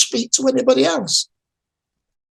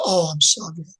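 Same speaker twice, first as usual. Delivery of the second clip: softer, lower imitation of condolence; mock quiet sympathy; 5 semitones lower, 5 dB quieter than usual. People don't do this for backache, mock hushed sympathy. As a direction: go hushed, as if imitating someone pitying. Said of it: "I go really quiet".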